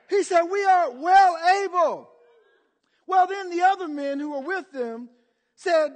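loud, high preacher's proclamation; ringing, emphatic sermon delivery; full-force preaching. Real, not acted. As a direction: drive it out to the whole congregation.

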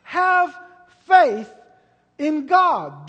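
"Have" "faith" "in God" each hammered high and loud with pauses; emphasis on each word. Shown as a demonstration. Preacher stressing the call to faith word by word. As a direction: punch every word apart. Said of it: "put an emphasis on faith".

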